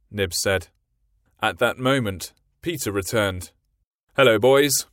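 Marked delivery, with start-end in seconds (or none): 4.2-4.9 louder cheerful hello; cheerful greeting. A narrator's reading. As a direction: Peter cheerfully greeting the boys. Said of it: "he cried cheerfully".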